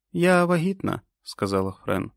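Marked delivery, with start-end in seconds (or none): 0.1-1.0 високий стриманий голос; зізнайся тривожно, піднявши тон; тривожне зізнання. A narrator's reading. Френ зізнається перед сльозами. (схвильоване зізнання)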